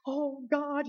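dramatised cry up to God, not full scream; mock scream. Moses cries to God at the sea, mock scream. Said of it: "he screams".

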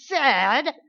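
loud put-on little-girl demand; playful toddler imitation; imitating toddler. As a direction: mimic her stubborn cry. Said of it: "and I'll say".

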